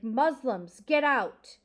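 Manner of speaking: loud, sharp angry shout; shouted in anger, pitch rising at the end; angry yell. A narrator's reading. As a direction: yell it like an angry mob member. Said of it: "angry men yelling angry things".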